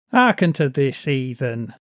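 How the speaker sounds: a lightly voiced mocking bellow; half-hearted bellow at a tavern crowd. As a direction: call the crowd's attention with scorn. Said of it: "he bellowed".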